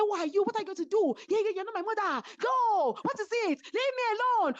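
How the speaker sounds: high, heated mock argument; retelling a shouting match, voice pitched high; angry retort. Retold quarrel, the other woman shouting.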